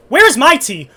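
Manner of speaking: loud, high indignant demand. Real, not acted. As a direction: demand it, outraged. Mocking the principal's tea break.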